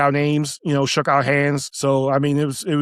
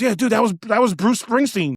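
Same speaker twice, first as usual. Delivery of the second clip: imitated astonishment in a higher voice; incredulous reveal; 7 semitones higher than usual. Onlooker telling the bouncer whom he turned away, incredulous. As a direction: break the big news, pitched up in disbelief.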